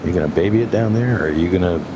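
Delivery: usual speaking voice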